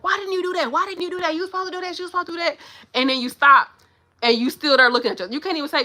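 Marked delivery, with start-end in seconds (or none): 0.0-2.6 high-pitched, rapid self-scolding; mock tantrum, berating yourself over and over; mad at yourself. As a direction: nag yourself in a high, worked-up voice. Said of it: "It's like you being mad at yourself".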